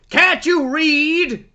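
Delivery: doorman snapping. Retold or imitated. Doorman scolding visitors who ignored the sign, loud slow snap. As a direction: talk down to them, slowly and loud. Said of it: "I think he goes".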